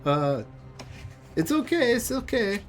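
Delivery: put-on high, reassuring foreign accent; squeaky hammed-up accent; [name]'s horrible accent. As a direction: soothe them in a silly high-pitched accent. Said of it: "horrible accent of [name]".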